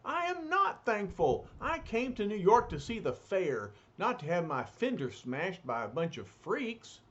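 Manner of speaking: put-on high, complaining old-man voice; indignant old man. Old man complaining about his fender, high squawk.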